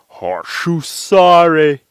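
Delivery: loud, slow reversed syllables; speaking backwards. Shown as a demonstration. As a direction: stretch "Horseshoe sorry" into "hor-shoo-saa-ray". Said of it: "That sounds like horseshoes are sorry".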